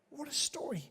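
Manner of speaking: a breathy aside, whispered. Real, not acted. Pastor marvelling at the story to the church, whispered aside. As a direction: let it slip out under your breath.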